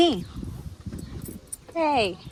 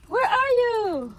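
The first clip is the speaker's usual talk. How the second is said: normal talking voice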